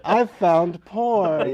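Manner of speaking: put-on slow, drawn-out kid's discovery; lightly voiced son finding a secret stash; imagined son. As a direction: stretch it out like a thrilled twelve-year-old. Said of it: "he'll route through there and think".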